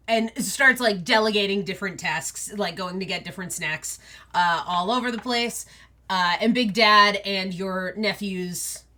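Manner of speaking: ordinary talk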